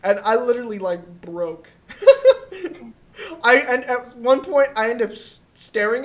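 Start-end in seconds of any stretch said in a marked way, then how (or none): 1.9-2.9 burst of laughter. Real, not acted. Recalls breaking down.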